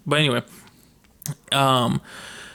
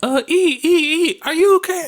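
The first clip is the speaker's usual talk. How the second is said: put-on worried kids. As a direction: imitate kids checking on him. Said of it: "They was like".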